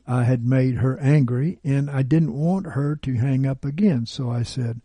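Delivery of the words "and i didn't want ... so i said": plain reading voice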